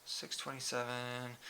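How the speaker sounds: soft mumble reading a number; muttered under breath. Real, not acted. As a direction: mutter it quietly to yourself. Reading splits aloud to himself.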